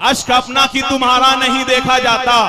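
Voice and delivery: declaimed Urdu couplet, slow and stately; poetry recitation. Acted. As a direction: recite it to a mushaira crowd. Reciting a sher at mushaira.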